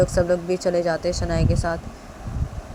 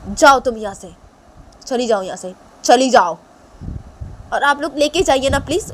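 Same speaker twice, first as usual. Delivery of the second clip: loud stern father, fading to cold; angry father; 4 semitones higher, 6 dB louder than usual. Acted. Father telling strangers to take daughter, loud and cold. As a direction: bark her away, then tell them to take her. Father sending her away.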